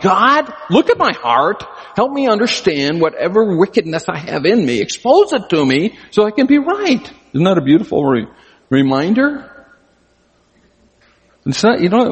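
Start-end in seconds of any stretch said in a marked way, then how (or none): 0.0-7.1 high, earnest plea to God; pleading prayer. Acted. Pastor voicing a psalm as prayer to God, pleading. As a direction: pray it out, pitched high.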